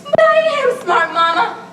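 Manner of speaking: high, loud and slow; insistent outburst. Acted. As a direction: plant each word hard. Bella insisting to her mother.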